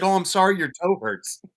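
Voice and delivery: high, loud sarcastic sorry; mock apology. Amputee mock-apologizing to the speaker, loud and high sarcastic. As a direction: pretend remorse while still ribbing. Amputee keeps teasing him.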